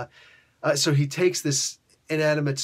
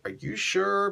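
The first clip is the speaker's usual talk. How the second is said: doubtful Galactus; 7 semitones higher, slower than usual. Acted. Galactus hesitantly questions the Surfer.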